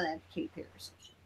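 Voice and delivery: fast whispered aside. Real, not acted. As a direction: toss it off under your breath. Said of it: "dismissive aside".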